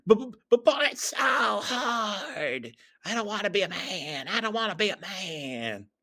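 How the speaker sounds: hammed-up blubbering tantrum; whiny crybaby. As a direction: wail childishly in protest. Crybaby narrator whines back at Tails.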